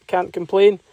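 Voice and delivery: ordinary talk, nothing marked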